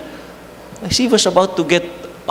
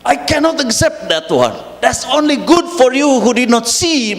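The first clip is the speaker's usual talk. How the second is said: father scolding; 8 semitones higher than usual. Retold or imitated. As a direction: relive the father's rebuke with mild heat. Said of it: "So he shouted at me".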